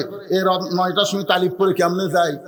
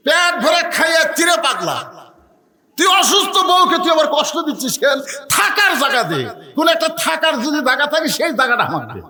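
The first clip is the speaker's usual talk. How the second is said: father's rebuke, higher and raised; angry scolding; 7 semitones higher than usual. Acted. Father scolds his son for turning him away, angrily. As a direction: scold the son in a stern, rising voice.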